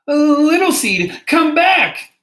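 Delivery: high, loud storybook shout; drawn-out, high-pitched cry to come back. A narrator's reading. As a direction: call desperately after a friend being taken away. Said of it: "cried little fox".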